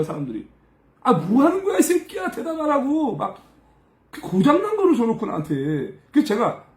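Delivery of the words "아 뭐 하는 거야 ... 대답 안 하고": high-pitched, indignant telling-off; acted angry cursing. Coworkers cursing the assistant over the radio, angry and high. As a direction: imitate an irate coworker swearing. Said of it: "이러면서 막 욕하는 거야".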